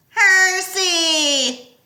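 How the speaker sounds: high, loud urgent call; shouting. A narrator's reading. Someone shouting to warn Percy. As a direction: call his name in alarm.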